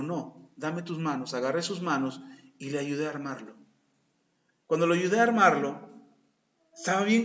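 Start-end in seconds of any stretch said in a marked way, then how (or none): 0.0-1.3 gentle. Retold or imitated. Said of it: "Y le digo".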